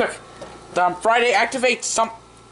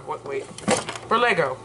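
normal talking voice

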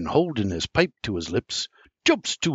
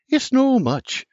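grandma's voice; 13 semitones higher, 3 dB louder than usual. A narrator's reading. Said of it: "and says".

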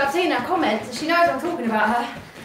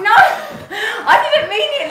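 laughing through the words; giggling while protesting; 7 semitones higher, 5 dB louder, slower than usual. Real, not acted. Laughing apology to Welsh viewers.

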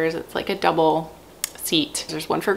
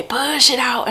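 encouraging push; 7 semitones higher, 4 dB louder, slower than usual. Shown as a demonstration. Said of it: "I kinda make a pushing sound".

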